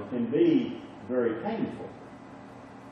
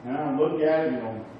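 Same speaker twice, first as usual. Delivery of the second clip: ordinary talk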